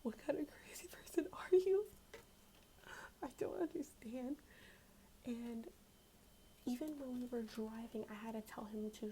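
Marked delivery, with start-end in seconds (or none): 0.0-4.4 high, loud disbelief; outraged exclamation. Real, not acted. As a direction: burst out at how crazy it is. Outraged at his ninety mph.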